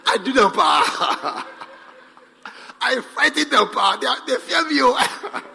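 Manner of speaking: gleeful, loud and laughing through the words. Acted. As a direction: chuckle over the scared choir. Gloating laugh about scaring choir.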